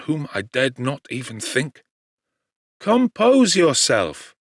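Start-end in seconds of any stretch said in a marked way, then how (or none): 2.8-4.3 slow, higher soothing plea; calm your friend gently. A narrator's reading. Clerval calms agitated Victor, slow and soothing. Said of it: "said Clerval".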